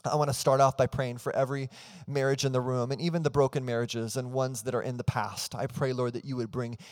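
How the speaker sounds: earnest spoken prayer that opens with a loud Father; congregational prayer. Real, not acted. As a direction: pray aloud over the room with rising fervor. Leads the church in prayer.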